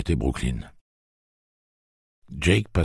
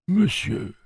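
put-on high, mildly surprised old man; slightly surprised. Old man musing after Jake left, slightly surprised. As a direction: repeat the word with a touch of wonder. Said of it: "d'une voix légèrement étonnée".

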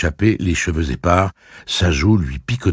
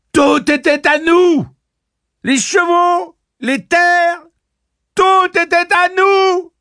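very high, loud cries of outrage; furious high yelling; enraged screaming; 7 dB louder, slower than usual. A narrator's reading. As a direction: scream each claim, half mad with rage. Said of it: "hurla-t-elle, rendue quasi folle de rage".

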